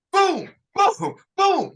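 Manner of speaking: high, loud punched booms; mock gunshots. Recruit acting out his shots, loud booms. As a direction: fire off each boom like a rifle.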